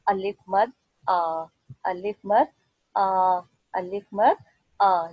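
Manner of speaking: "आ" held long and slow after "अलिफ मद". Shown as a demonstration. Teacher drawing out "आ" so children hear its length. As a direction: stretch "आ" slowly and clearly. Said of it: "आवाज़ है वो थोड़ी लंबी हो जाती है".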